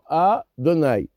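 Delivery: Adonai with a brief stop after the A; pause after first syllable. Shown as a demonstration. Teacher showing the Shem Adanout, Adonai split after A. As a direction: split Adonai as A, then donai run together. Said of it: "faire un arrêt sur la première syllabe".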